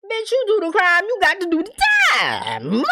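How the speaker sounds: high-pitched, emphatic outburst; exasperated scolding. Real, not acted. Viewer scolding a killer dodging blame, exasperated. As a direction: cry it out high, hammering the point. Host exasperated, lecturing the character.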